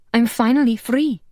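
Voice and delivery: plain reading voice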